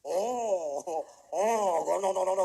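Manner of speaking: mocking Japanese accent, loud and clipped; Japanese captain impression. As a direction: imitate a Japanese ship captain's broken English. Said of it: "ganito sila magsalita".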